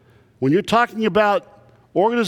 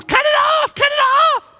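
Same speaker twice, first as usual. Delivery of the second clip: piercing high operatic scream, much higher and louder; soprano screaming; 4 dB louder than usual. Retold or imitated. Wife screaming from upstairs as water floods, high loud scream. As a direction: scream it like a trained soprano at full lungs. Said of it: "soprano screaming at the top of her lungs".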